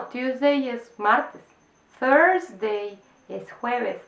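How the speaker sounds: "Tuesday es martes, Thursday es jueves" said very slowly, each day spelled out; slow contrast. Shown as a demonstration. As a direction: set the two days apart slowly. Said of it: "contrasting the two words". ("Tuesday" y "Thursday" muy despacio, contrastando)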